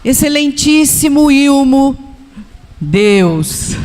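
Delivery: mock pompous formal address; a hint of grandiose ceremony; formal prayer address. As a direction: puff it up like a stiff official letter. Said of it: "ele falava assim pra Deus".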